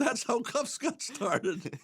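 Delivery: high voice breaking into laughter; laughing while talking. Real, not acted. Host debunking the cuffs fad, laughing while talking. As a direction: push the punchline out through laughter. Laughs through his own punchline.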